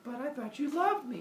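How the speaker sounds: high, whiny guilt trip; pleading queen. Acted. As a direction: whine to make him feel bad. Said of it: "She goes".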